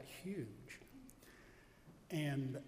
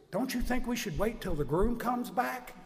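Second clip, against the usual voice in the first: higher and louder, insistent; urgent interruption; 8 semitones higher, 7 dB louder than usual. Retold or imitated. Guest asking the priest to wait for the groom, insistent. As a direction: press the point from the pews.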